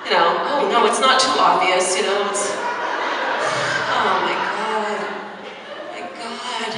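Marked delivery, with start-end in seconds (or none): 2.5-6.7 laughing groan. Real, not acted. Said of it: "reliving the leak".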